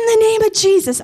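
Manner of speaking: commanding in retelling; retold command. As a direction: call it out high, without extra volume. Retold command at angry man.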